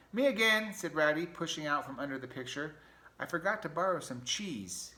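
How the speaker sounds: high, loud cheery rat; Ratty voice. Acted. Ratty waking Mouse to borrow cheese, high and cheery. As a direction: pop back in unbothered.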